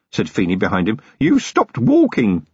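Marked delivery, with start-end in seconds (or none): 1.2-2.5 high, slow, uneasy observation; nervous young constable. A narrator's reading. Feeney to Vimes as he halts, nervous. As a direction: stretch it out anxiously, pitched up.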